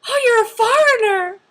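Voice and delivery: high, loud exclamation; hammed-up loud surprise; surprised ajumma. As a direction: imitate an ajumma's astonished discovery. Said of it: "she was just like".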